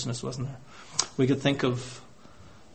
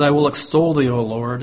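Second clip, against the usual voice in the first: louder, ringing scripture proclamation; proclaimed verse; 8 dB louder than usual. Acted. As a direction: declaim it to the congregation. Preacher proclaims verse from psalm.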